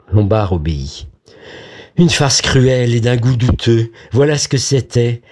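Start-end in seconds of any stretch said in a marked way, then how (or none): none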